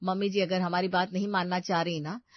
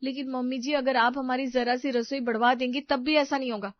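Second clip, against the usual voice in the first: raised, insistent higher voice; complaining daughter-in-law; 6 semitones higher, 4 dB louder than usual. Acted. Daughter-in-law asking mother-in-law for a bigger kitchen, complaining. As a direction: press the complaint, pitched up.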